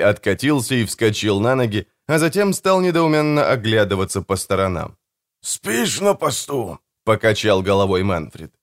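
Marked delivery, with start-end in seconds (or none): 5.4-6.8 slow, higher-pitched reproach; reproachful question. A narrator's reading. Manfred finds his brother asleep on watch, reproachful. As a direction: chide slowly, in disbelief.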